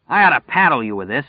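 a mild shout. Acted. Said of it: "he shouted at her".

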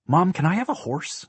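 put-on high, eager child's question; pleading child. A narrator's reading. Daughter asks Mom for a horse, high and eager. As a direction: ask Mom hopefully in a high little voice.

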